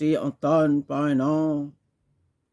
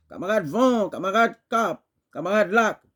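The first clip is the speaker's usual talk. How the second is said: higher, chanted roll call; rousing summons; 6 semitones higher, faster than usual. Acted. Poet summoning wind, cape and lake, raised rallying call. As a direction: call each comrade up in rhythm.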